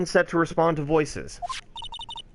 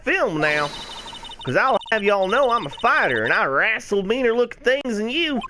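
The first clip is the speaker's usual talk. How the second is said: put-on Southern accent; 2 semitones higher than usual. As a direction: scold like a country woman with a Southern accent. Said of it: "I'll give her a Southern accent".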